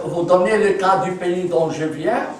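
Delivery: ordinary talk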